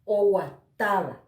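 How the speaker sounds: Oguataba with a loud, stressed TA; stressed tonic A. Shown as a demonstration. Teacher shows where the voice carries most, TA in Oguataba stressed. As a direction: lean hard on the TA in Oguataba. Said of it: "la mayor intensidad".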